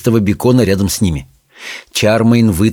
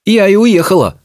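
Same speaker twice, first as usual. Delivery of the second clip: witch's retort; 9 semitones higher than usual. Acted.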